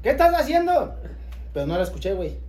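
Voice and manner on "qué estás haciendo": high, loud mom shout; shrill mom yell. Retold or imitated. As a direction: yell it like a startled mother. Retold mother, louder.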